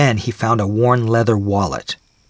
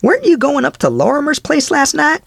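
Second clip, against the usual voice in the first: much higher, louder village storekeeper; a nosy shopkeeper, pitched up high; 4 dB louder than usual. A narrator's reading. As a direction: storekeeper quizzing Truscombe, high and loud.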